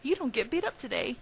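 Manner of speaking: ordinary delivery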